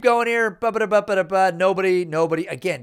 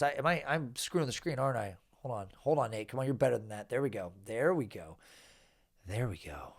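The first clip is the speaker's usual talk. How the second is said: much softer and lower, to himself; muttered aside; 8 semitones lower, 11 dB quieter than usual. Real, not acted. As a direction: mumble it while fiddling with the screen. Muttering to himself fixing screen.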